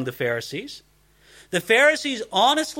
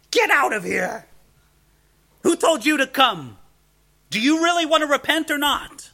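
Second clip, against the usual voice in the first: screaming at them; 9 semitones higher than usual. Retold or imitated. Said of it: "he starts screaming at them".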